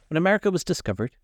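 high, quick self-important opener; pompous lecturer. A narrator's reading. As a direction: launch into a lecture. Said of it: "began to give some wearisome facts".

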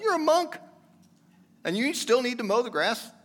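incredulous question. Acted. Imagined listener doubting the monk.

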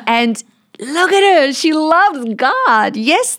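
high, loud and drawn out with wonder; lifting high and loud over her; excited vision. Retold or imitated. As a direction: swept up in delight at the bride.

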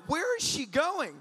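high, loud, drawn-out question; stretched, incredulous near-yell; bewildered disbelief. Acted. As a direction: ask it in exaggerated astonishment. Bewildered watching woman run off.

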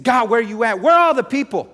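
high, loud, raw shout at God; yelling out, voice going raw; angry cry to God. Retold or imitated. Preacher reliving shouting at God in his car, furious. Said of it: "And I was mad".

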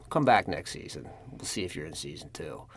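low, quiet mock agent dismissal; brush-off. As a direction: trail down into a soft, noncommittal put-off. Agents brush off hopeful actor.

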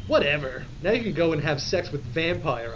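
ordinary delivery, nothing marked

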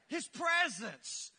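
stretched slowly, word by word; slow drawn-out answer. Shown as a demonstration. Pastor drawing the answer from church, slowly. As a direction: let the answer land slowly. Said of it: "two of us said that".

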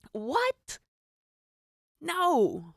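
high, loud outburst of disbelief; stunned What then loud No; shocked disbelief. Retold or imitated. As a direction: yelp at the price. Reacting to the Uber price jump.

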